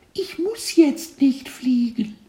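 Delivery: very high whispery little voice, slow; cartoon kid voice. A narrator's reading. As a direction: stress on nicht, cheeky tiny caterpillar. Caterpillar answers teacher.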